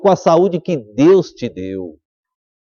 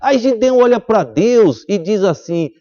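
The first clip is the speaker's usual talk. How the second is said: ordinary talk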